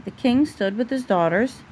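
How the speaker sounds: usual speaking voice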